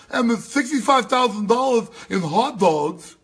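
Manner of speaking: high, loud mock character; high loud caricature of opponents. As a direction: ham up an imagined elite.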